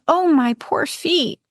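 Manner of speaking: high, slow moaning; pained moan. Acted. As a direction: groan about her poor feet. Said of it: "She moans".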